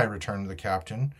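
ordinary delivery, nothing marked

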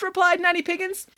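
ordinary delivery

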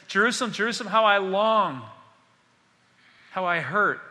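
ache aloud over a beloved city; impassioned lament; Jesus lamenting. Acted. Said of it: "he's praying over Jerusalem".

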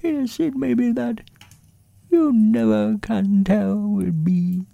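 very high, small voice for a pondering bear; high-pitched bear explaining earnestly; Winnie the Pooh. A narrator's reading. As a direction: speak as a thoughtful little toy bear, high and thin.

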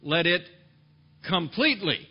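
completely punched loud; preacher hammering the word completely to the congregation; stressed completely. Shown as a demonstration. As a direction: lean hard on completely. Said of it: "Did you hear what he said there?".

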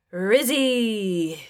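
put-on slurred drunk singsong call; drunken glee. A narrator's reading. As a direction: call a name with tipsy glee. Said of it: "he muttered with drunken glee".

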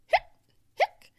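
sharp spaced hics; abrupt hiccup bursts; two hiccups. A narrator's reading. Girl hiccuping while waiting, sharp hiccups. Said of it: "Sophia hiccuped twice".

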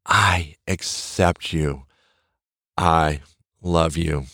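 tender self-affirmation. Shown as a demonstration. Host showing listeners how to talk to themselves, tender and slow. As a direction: say it gently to yourself in the mirror. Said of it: "you say your name and say".

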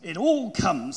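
loud, mildly cross storybook retort. A narrator's reading. Pooh blames Rabbit's small front door, cross retort. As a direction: snap back as a grumpy Pooh. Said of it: "said Pooh crossly".

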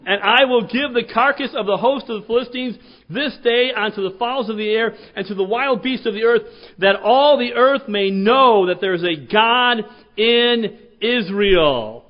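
loud, slow proclamation; proclaiming shout. Retold or imitated. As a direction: thunder it out to the army. David's proclamation continued.

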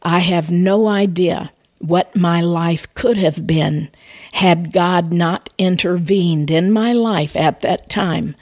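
ordinary talk

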